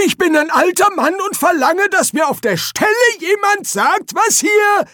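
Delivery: put-on very high, loud old man's rant; irate old wizard. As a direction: demand answers in a shrieky codger voice. Old Poons angrily demands answers.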